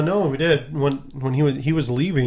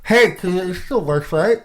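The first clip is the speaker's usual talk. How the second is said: talking around a pen; words round a pen in the mouth; pen in teeth; 4 semitones higher than usual. Real, not acted. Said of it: "I'll put my pen in my mouth".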